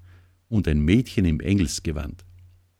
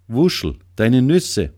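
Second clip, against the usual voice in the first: loud, higher warning cry; urgent shout; 6 semitones higher, 6 dB louder than usual. A narrator's reading. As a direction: yell the warning to his friend. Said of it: "schrie Flori". (laut warnender Ruf)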